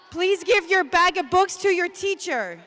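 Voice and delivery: loud and high, projecting over a noisy gym; calling out to the room. Real, not acted. Teacher to a gym of kids, projected loud call. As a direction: call it out so the back rows hear. Teacher addresses whole assembly.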